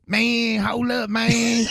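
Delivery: high, dragged-out Texas girl impression; mock the girls with a high, slow drawl. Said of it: "I hate when girls talk like that".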